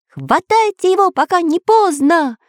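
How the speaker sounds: high, urgent rallying cry; shouted order. A narrator's reading. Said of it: "выкрикнул Самоделкин". (срочный выкрик)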